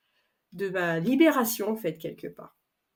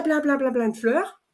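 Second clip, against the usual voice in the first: ordinary delivery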